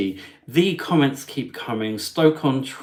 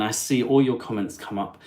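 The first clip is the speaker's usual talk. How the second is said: ordinary delivery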